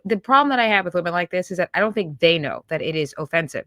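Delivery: ordinary talk, nothing marked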